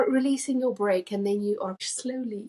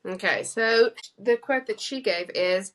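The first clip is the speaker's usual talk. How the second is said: ordinary talk, nothing marked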